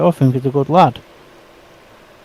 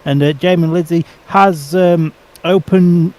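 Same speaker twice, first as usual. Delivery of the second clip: lively play-by-play, a bit higher; race commentary; 4 semitones higher than usual. Pretend. Mock live race commentary.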